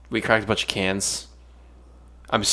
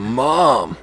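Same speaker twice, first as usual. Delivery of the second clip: mock shocked; 7 semitones higher than usual. Mock disgusted reaction.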